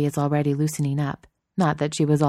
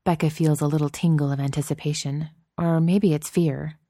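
usual speaking voice

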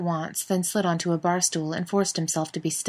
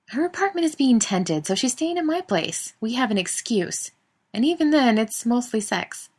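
higher lightly voiced woman; woman's voice; 7 semitones higher than usual. A narrator's reading. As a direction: read her lines in a higher voice. Daniil voiced high on phone.

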